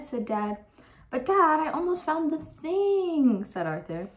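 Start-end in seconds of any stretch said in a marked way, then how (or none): 1.1-3.4 high, drawn-out protest; protesting kid. Acted. Son argues with Dad at bedtime, protesting. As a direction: plead against bedtime, pitched up.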